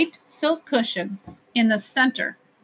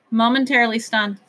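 ordinary delivery

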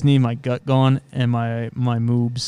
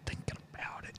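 breathy whisper; 20 dB quieter than usual. Real, not acted. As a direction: whisper it, conspiratorial. Whispered reply.

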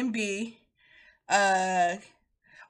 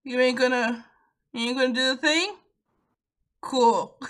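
imitating a wary check, higher and louder; mock cautious questions, slightly raised voice; wary friend; 4 semitones higher, 6 dB louder than usual. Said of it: "he's like".

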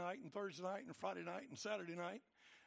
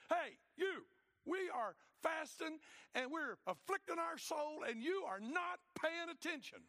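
mock complaining shout; 7 semitones higher than usual. People complaining loudly to God.

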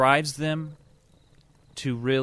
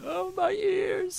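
high, slow hammed-up moan; mock pained; much slower than usual. Co-host echoing the joke, mock pained moan. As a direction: stretch it out like it hurts. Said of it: "echoing the joke".

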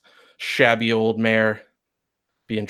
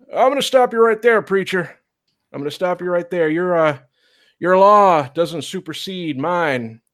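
put-on higher, steady old lawman; old marshal; 8 semitones higher, 3 dB louder than usual. Old marshal facing down the preacher, firm and high. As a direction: calmly overrule the preacher, slowing at the end.

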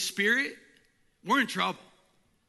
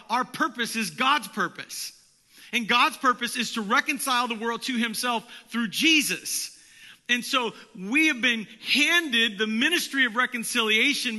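louder, fervent sermon delivery; preaching; 5 semitones higher, 6 dB louder than usual. Acted. Pastor urging his church to follow the Holy Spirit, preaching at full force. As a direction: drive the point home from the pulpit.

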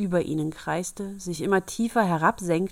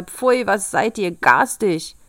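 slow scornful dwarf voice, a little louder; dwarf's slow sneer; 6 dB louder, slower than usual. Acted. The trapped dwarf sneering at the girls, slow and scornful. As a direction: drawl out the insult with contempt.

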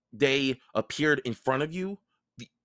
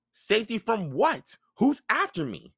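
alarmed Percy; 12 semitones higher than usual. Acted.

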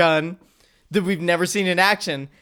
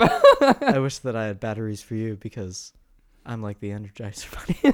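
mock seductive; 8 semitones lower than usual. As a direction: tease a friend with a hammed-up lovesick murmur. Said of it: "he's just like".

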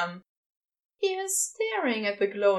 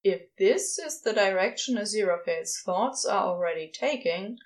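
plain reading voice

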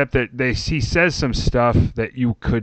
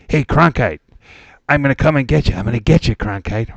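crank caller voice; 3 semitones higher, 4 dB louder, faster than usual. Retold or imitated. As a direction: taunt him like a crank caller. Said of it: "a crank caller".

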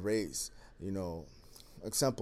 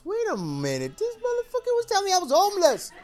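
higher, louder and fast, laughing in disbelief; mock outrage through a laugh; indignant realization; 8 dB louder than usual. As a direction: it just dawned on you that you were insulted. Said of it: "that's when I realized".

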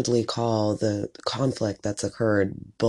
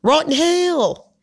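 very high, slow, louder Rotten hell in a bad Southern accent; botched Southern accent; 4 dB louder, slower than usual. Imitating Brittany's Southern accent, badly. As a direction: overdo a Southern accent that slides toward British. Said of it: "British or, like, Australian when I do it".

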